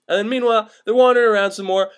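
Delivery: ordinary delivery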